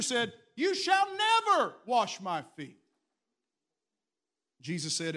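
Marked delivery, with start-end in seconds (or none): 0.6-2.8 loud, high, slow outraged protest; Peter refusing. Acted. Peter refuses to let Jesus wash him, loud and high.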